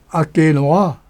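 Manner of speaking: ordinary talk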